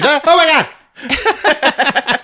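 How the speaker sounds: high, drawn-out mock shriek; hammed-up horrified wail; panic. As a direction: flip out at a high pitch, stretching it. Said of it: "She doesn't know what to do".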